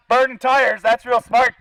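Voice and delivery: ordinary talk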